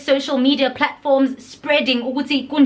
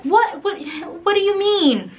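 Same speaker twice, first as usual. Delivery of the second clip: baffled what; 8 semitones higher than usual. Retold or imitated. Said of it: "I'm like".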